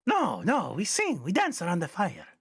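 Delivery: very high-pitched, hospitable goblin; high, singsong friendly goblin; goblin character, welcoming. Acted. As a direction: invite them over in a high goblin voice.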